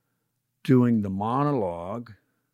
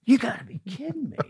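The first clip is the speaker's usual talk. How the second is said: exasperated disbelief; 9 semitones higher than usual. Retold or imitated. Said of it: "looking at him going like".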